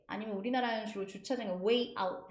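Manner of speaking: ordinary talk, nothing marked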